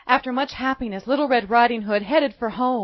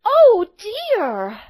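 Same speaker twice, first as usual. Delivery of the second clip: put-on worried little girl; 12 semitones higher, 9 dB louder than usual. A narrator's reading.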